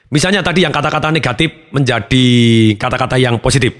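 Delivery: usual speaking voice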